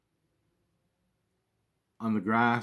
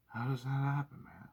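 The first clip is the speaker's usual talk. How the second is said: hushed wonder; 3 semitones higher, 9 dB quieter than usual. Retold or imitated. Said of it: "I just like".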